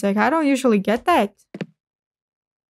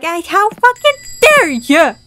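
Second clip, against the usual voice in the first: mock outraged customer, higher and louder; angry customer; 5 semitones higher, 5 dB louder than usual. Clerk mimicking an angry customer's complaint, mock outrage. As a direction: ham up an entitled customer's fury. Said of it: "they're gonna be like".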